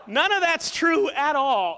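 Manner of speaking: laughs through it loud and high; laughing. Real, not acted. Pastor laughing loudly as he admits the made-up story. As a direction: confess the joke while cracking up.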